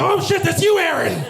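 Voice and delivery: high, startled shout, dropping on the name; shocked exclamation. Retold or imitated. Friend recognizing him in the stall, shocked high shout. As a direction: blurt it out in loud, high surprise.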